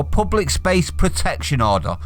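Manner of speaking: usual speaking voice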